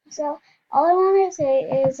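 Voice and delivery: ordinary delivery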